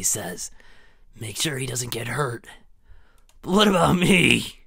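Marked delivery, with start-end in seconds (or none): 3.4-4.6 loud protest. Acted. Character protests being left out.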